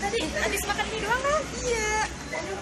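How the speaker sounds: high, loud whiny pain cries; whimpering in pain. Acted. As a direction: whine like your stomach hurts. Sudden sickness after eating. (merintih kesakitan)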